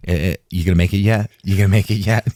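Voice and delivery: loud, fast, teasing nag; mock pestering. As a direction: badger him playfully, half-joking.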